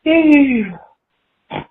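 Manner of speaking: ordinary talk, nothing marked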